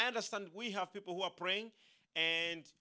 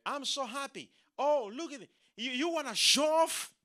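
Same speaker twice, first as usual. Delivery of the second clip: higher and louder fake delight; mock gushing friend; 7 semitones higher, 6 dB louder than usual. Preacher mimicking a jealous friend, mock gushing. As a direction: ham up the fake friend's cheer.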